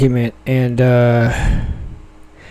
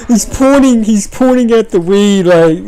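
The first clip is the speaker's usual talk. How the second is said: laughing; 6 semitones higher, 3 dB louder than usual. Real, not acted. Laughing while explaining clip.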